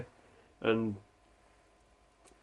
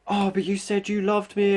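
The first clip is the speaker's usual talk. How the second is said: mock whiny girlfriend; 8 semitones higher than usual. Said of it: "they go".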